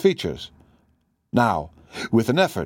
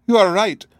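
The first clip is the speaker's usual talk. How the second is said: high, louder cry in a foreign voice; 9 semitones higher, 5 dB louder than usual. A narrator's reading. Hidden woman bursting out to Holmes, crying out. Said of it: "she cried in a strange, foreign voice".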